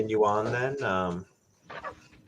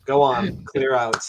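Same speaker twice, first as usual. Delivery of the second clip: louder, firm order to clear out; mild command; 4 semitones higher, 7 dB louder, slower than usual. Acted. Player character ordering kobolds out, loud. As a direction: shoo them out with a raised voice.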